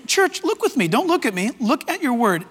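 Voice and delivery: ordinary talk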